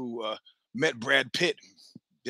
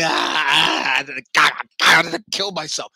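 mortified groan; 3 semitones higher, 9 dB louder, slower than usual. Retold or imitated. Retelling his mortified reaction.

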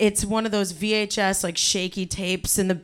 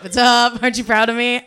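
louder proud call to family; proud kid; 2 semitones higher, 8 dB louder than usual. Retold or imitated.

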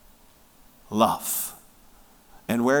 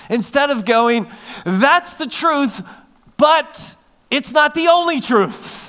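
higher and louder, hammering each quote; full-force preaching; 8 semitones higher, 6 dB louder than usual. Real, not acted. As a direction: preach the contrast hard.

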